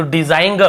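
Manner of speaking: डिजाइन ग with an added "guh" at the end; G sounded. Shown as a demonstration. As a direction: misread design as "dizain-guh", डिजाइन ग. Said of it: "ऐसे ग को नहीं पढ़ रहे".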